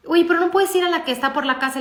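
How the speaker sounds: high, quick imitation of an insistent child; mock urgent pleading; pleading protest. Daughter pleads for another donut shop.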